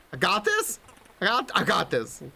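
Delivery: high character voice, unsure pep talk; climber rallying. Acted. Climber character trying to believe it.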